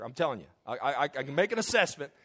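normal talking voice

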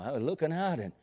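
normal talking voice

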